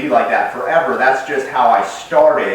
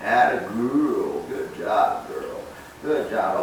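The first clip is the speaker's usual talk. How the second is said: soft, slow, loving praise half-whispered; lavish the puppy with gentle love; affectionate praise; 3 dB quieter, much slower than usual. Shown as a demonstration. Trainer praising the puppy after a good sit, softly and lovingly. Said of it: "that's when I give all the love, all the affection".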